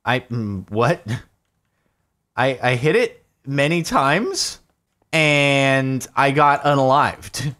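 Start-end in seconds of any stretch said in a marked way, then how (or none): none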